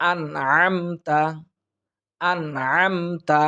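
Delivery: আনআমতা chanted slowly with a clean ন; clear izhar. Shown as a demonstration. Teacher repeating izhar for students. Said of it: "গুন্না হবে না".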